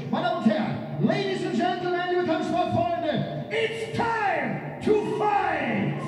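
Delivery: booming ring announcer hype; brash fight promoter hype. Real, not acted. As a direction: stretch it out for the crowd. Ring announcer hyping the crowd.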